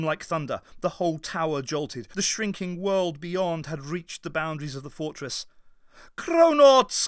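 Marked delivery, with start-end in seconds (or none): 6.2-7.1 loud high call from above; loud summoning call. A narrator's reading. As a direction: call down to them loudly. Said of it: "called the Doctor from above".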